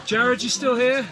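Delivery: loud high call. Real, not acted. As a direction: call down to him. Calling out to someone below.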